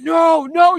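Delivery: high, loud frantic cry of no; husband seeing the lion creep toward his wife, high frantic cry; mock panicked no. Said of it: "And he's like".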